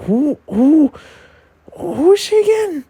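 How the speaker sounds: very high, baffled squeal; puzzled squeak. Retold or imitated. Fan failing to remember her friend, in a high puzzled squeak. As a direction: squeak it out in mock confusion. Acts out own puzzled reaction.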